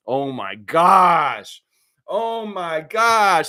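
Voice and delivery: long, drawn-out groan of disbelief; exasperated disbelief. Real, not acted. Streamer reacting to a politician's gaffe, drawn-out exasperation. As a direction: stretch the words out slowly, incredulous. Reacting to Vance's cheese question.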